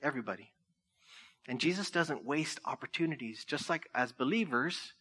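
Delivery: normal talking voice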